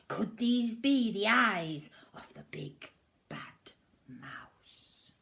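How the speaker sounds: whispered storytelling suspense; suspenseful whisper. A narrator's reading. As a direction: hush them, is it the mouse. Spooky suspense.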